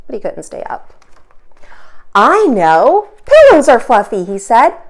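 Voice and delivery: lively, expressive storybook reading; animated read-aloud for kids; reading to children. A narrator's reading. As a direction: read it aloud for little listeners. Teacher reads picture book aloud.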